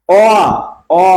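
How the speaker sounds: rounded drawn-out অ; demo vowel o. Shown as a demonstration. Teacher showing students the vowel অ. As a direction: sound অ long and clear. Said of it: "অটা দেখেন".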